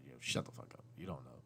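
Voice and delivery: low, soft dismissive aside; muttered retort. Retold or imitated. Dad answering people who give parenting advice, muttered low retort. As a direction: drop it under the voice, dismissively.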